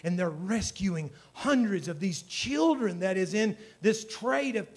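ordinary delivery

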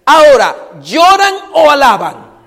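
loud, ringing call to the crowd; rousing challenge. Real, not acted. Preacher challenging the church to weep or praise, loud. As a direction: throw the question out to stir them up.

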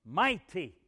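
"Mighty" punched out loudly; stress on MIGHTY. Shown as a demonstration. Preacher savoring his favorite word, loud stressed "Mighty". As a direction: hit "Mighty" hard and let it fall. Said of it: "I like to say it that way".

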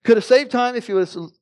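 fed-up retold yell; exasperated yell. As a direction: throw up your hands and shout it. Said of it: "I just yell".

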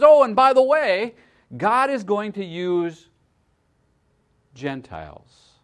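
loud, high, bright proclamation of Jesus; preacher voicing Jesus loudly, building then dropping to Gentiles; excited announcement. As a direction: fling the news at the crowd, slowing on the last words. Jesus' shocking line, crowd excited.

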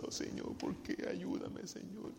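breathy whispered plea at the altar; whispered prayer. Retold or imitated. Worshipper at the altar whispering a plea to God. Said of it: "y usted dice".

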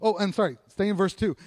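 normal talking voice

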